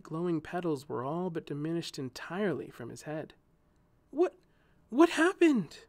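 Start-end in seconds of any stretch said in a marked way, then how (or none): 4.1-5.8 sudden high, loud cry of alarm; shocked exclamation. A narrator's reading. She sees her wounded friend, shocked cry. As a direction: gasp it out in shock. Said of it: "she exclaimed".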